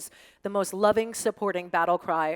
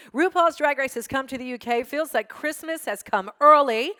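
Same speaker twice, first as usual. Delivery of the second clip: reading a fan's tweet higher and louder, slowing down for the Christmas line; excited fan tweet; 6 semitones higher, 6 dB louder than usual. Retold or imitated. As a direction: share the fan's glee like an early Christmas present. Gleeful fan tweet read aloud.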